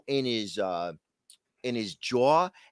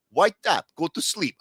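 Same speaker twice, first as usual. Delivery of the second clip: imitated fighter's command, raised pitch; Khabib; 3 semitones higher, 3 dB louder than usual. Retelling Khabib talking to his choked opponent, mock commanding. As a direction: order it at the opponent, a little heightened. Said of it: "Khabib was like".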